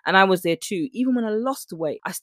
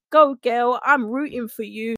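mock cheer; 5 semitones higher, 4 dB louder, slower than usual. Mocking influencer cheer.

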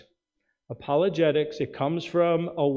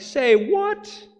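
high, loud incredulous "say what"; mock disbelief; 11 semitones higher, 6 dB louder than usual. Preacher reacting to the governor's reversal, high and incredulous. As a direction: toss out a stunned double take. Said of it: "I'm like".

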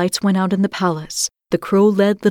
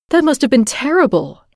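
put-on exclaiming child; 6 semitones higher than usual. A narrator's reading. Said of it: "little Gerda exclaimed".

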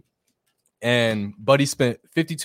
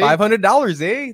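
higher and louder, with a hammed-up Canadian "eh"; mock Canadian accent; 8 semitones higher, 5 dB louder than usual. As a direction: shout it like a joking Canadian. Joins in the Canadian joke.

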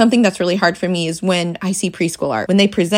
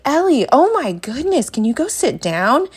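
exasperated; 7 semitones higher than usual. Retold or imitated. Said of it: "And I was like".

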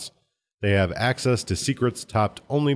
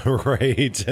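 laughing; 6 semitones higher than usual. Real, not acted. Laughing at the joke.

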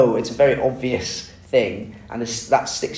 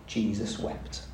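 solemn; 3 semitones lower, 10 dB quieter, slower than usual. Acted. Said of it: "the shortest Bible verse".

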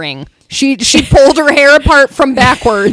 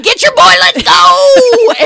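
high, mock party holler; rally them up high, as if at the club; rowdy hype; 8 semitones higher, 3 dB louder, much slower than usual. Acting out a club call to go, rowdy hype.

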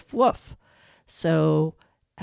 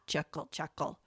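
soft chuckle, much softer than the laugh line; hushed chuckle; 3 semitones lower, 13 dB quieter than usual. Shown as a demonstration. Teacher contrasting laugh with a quiet chuckle, softly. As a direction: drop almost to a murmur. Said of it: "how about using synonyms".

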